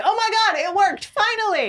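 high, loud burst of delighted relief; thrilled relief. Retold or imitated. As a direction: squeal it like it finally worked. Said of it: "I was like".

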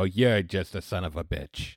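low-key, slow and soft; muttered judgment. Retold or imitated. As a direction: say it softly, drawn out. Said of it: "people look at us and go".

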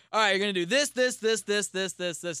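quick, high instructor counting steps; fast dance instructor. Retold or imitated. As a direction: rattle off the steps like a choreographer.

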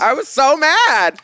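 mock-furious wail about walking the wrong way; hammed-up fury. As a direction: drag out each word in campy rage. Mock outrage echoing cohost.

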